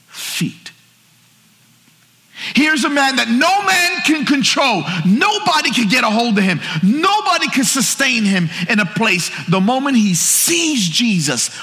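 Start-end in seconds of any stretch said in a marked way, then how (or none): 2.5-11.5 high-pitched, forceful preaching cry; preacher in full cry; impassioned preaching. Acted. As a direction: build to a fervent sermon peak.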